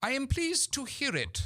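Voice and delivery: very high, haughty British dowager; grand lady approving with disdain; lofty upper-class matriarch; Lady Bracknell. Acted. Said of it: "says Lady Bracknell".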